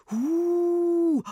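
long, high "hoo" like a ghost; ghostly howl. A narrator's reading. One prankster howls like a ghost to scare the children off, ghostly howl. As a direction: howl it out high and loud, holding the vowel. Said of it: "heulte der eine wie ein Gespenst".

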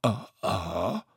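strained grunted reply; groaning with effort. A narrator's reading. Tete holding Bo up, groaning. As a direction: groan under a heavy load. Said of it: "Tete ächzte".

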